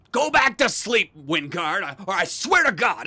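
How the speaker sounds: loud threat hollered to a neighbor; angry yell. Acted. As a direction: shout the neighbor back to bed. Yells at complaining neighbor.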